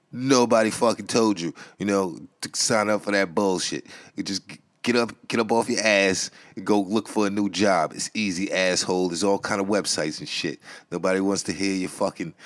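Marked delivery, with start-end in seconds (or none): none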